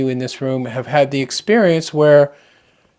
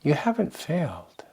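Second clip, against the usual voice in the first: softer, breathless hush of reassurance; reassuring whisper; 9 dB quieter than usual. Real, not acted. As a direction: whisper it gently to someone who fears they failed. Reassuring meditators after long pause.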